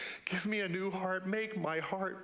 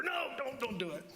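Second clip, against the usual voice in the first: a higher, urgent conscience voice cutting in; higher-pitched warning voice; inner voice; 9 semitones higher than usual. Acted. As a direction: stop yourself before you say it. Inner voice argues against speaking.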